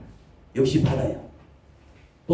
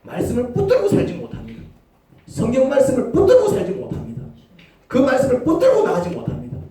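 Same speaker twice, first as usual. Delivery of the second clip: 붙들고 pushed higher and louder each time; building emphasis; 11 semitones higher, 4 dB louder than usual. Shown as a demonstration. Preacher hammering the congregation with 붙들고, each time higher and louder. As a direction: climb higher with every repeat of 붙들고. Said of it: "repeated with build".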